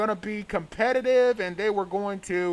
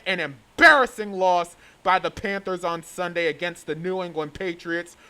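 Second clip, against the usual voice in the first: ordinary delivery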